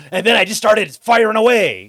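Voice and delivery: high, loud, unhinged sitcom-character voice; Frank Reynolds. Retold or imitated. Quoting a sitcom character to mock his own rage: high, loud, unhinged. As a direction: go full loose-cannon, shouted. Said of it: "it's like Frank Reynolds".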